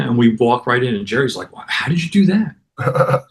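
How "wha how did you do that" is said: higher, slow, mock astonishment; amazed question. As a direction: imitate a friend baffled and impressed. Jerry amazed at free entry.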